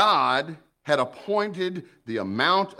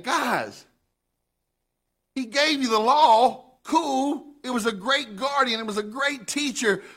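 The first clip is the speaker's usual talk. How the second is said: much higher, eager teaching tone; Paul, excited; 11 semitones higher than usual. Retold or imitated. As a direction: voice Paul as he enthusiastically explains. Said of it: "Paul was saying".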